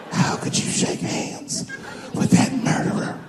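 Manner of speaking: hushed, slow, scandalized hiss; whispered rebuke. Retold or imitated. Agent scolding the comic, hushed and disgusted.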